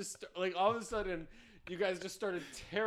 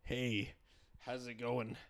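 lower, put-on American accent; American accent, pitched down; 5 semitones lower, slower than usual. Shown as a demonstration. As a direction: greet in a playful mock-American accent. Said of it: "Do an American accent".

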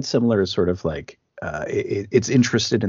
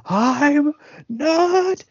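very high, slow shouted protest; high slow shout, I'm not Terry; mock protest; 4 dB louder, much slower than usual. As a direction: yell it as the terrified son. Acting Todd's protest.

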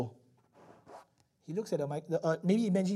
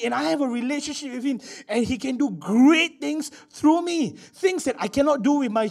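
higher, louder, rising preaching; fervent preaching; 7 semitones higher, 3 dB louder than usual. Real, not acted. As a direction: lift the congregation with fervor. Preaching builds to fervor.

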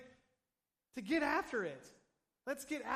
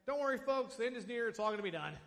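cheery reassurance; 3 semitones higher, 6 dB louder, faster than usual. Retold or imitated. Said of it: "Peter's like".

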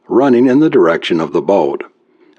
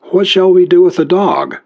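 higher, anxious woman's question; Carol's worried voice; 10 semitones higher than usual. Acted. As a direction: ask it worried and pitched up. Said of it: "asked Carol".

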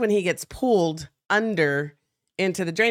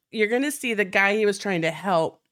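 ordinary delivery

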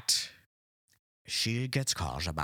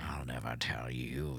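villain voice; 7 semitones lower, 6 dB quieter than usual. Pretend. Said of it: "You sound like an absolute villain".

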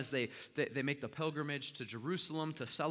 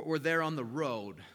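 ordinary talk

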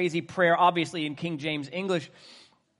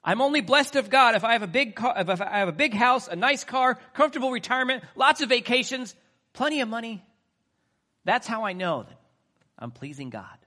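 loud, high mock boast of wealth; mocking prosperity talk; 8 semitones higher, 7 dB louder than usual. Preacher mocking greedy ministers, loud sarcastic boast. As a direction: sneer at the big-car gospel, loud and high.

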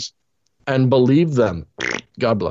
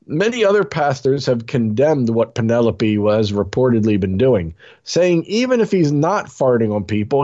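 ordinary talk

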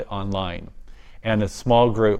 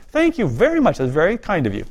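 politely grateful thanks, starting higher; 6 semitones higher than usual. Retold or imitated. Thanking the neighbor for the bushel, politely. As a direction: thank them graciously and properly. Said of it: "I said very politely".

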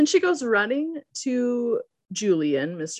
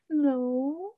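high, giggly fake "no"; mock-sheepish, high-pitched denial; unconvincing denial; 7 semitones higher, 4 dB quieter than usual. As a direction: badly defend yourself with a nervous titter. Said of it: "really bad job trying to defend herself".

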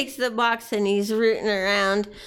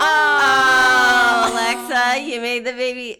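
excited; 2 semitones higher, 6 dB louder than usual. Acted. Azada exclaiming at baby.

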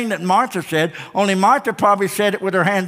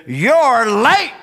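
indignant, raised-voice scolding; scolding Martha; 3 semitones higher, 3 dB louder than usual. Acted. Martha confronting Jesus with hands on hips, scolding. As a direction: chide him, punching the word late. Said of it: "her hands are on her hips".

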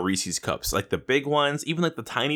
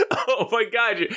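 ordinary talk

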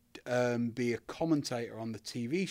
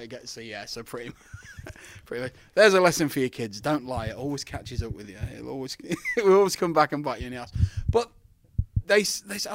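ordinary talk

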